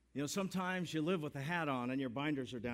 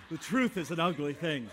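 laughing through the line, louder; a big real laugh carrying the words; 4 semitones higher, 8 dB louder than usual. Real, not acted. Pastor laughing at own joke.

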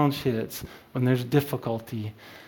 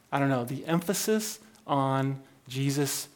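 normal talking voice

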